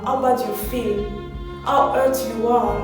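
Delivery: ordinary talk, nothing marked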